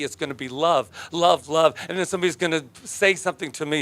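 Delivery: normal talking voice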